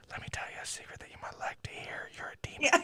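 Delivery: fast, mock spooky whisper; creepy whisper. Imitating the movie's whisper to the boy, spooky. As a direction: hiss out the secret to scare. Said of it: "Oh, here it goes".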